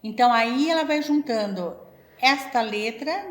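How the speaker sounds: ordinary delivery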